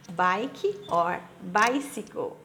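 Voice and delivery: Bike or bicycle said slowly and clearly; slow it down so they can copy Bike or bicycle; model phrase, slowly. Shown as a demonstration.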